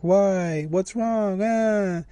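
put-on high-pitched, drawn-out child's whine; whining kid. Kid whining at the mother dragging him home, mock whine. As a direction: imitate a kid whining in protest. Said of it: "and he was whining".

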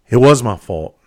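loud, raw burst of self-blame; guilt outburst. Real, not acted. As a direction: let the guilt break out loud.

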